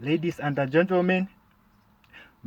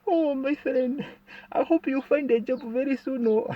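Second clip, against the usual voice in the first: mock emotional pity; 11 semitones higher than usual. Comedian mimics a friend getting emotional over his poverty, high and syrupy. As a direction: drag it out high like false sorrow. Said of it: "they will start getting emotional".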